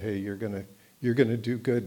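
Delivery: ordinary talk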